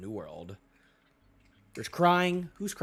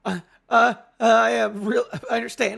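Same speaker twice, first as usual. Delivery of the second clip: flustered apology; 6 semitones higher, 9 dB louder than usual. Acted.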